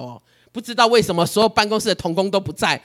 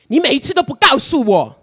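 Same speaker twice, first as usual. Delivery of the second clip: accusing God; 8 semitones higher, 8 dB louder than usual. Retold or imitated. Retells complaining to God.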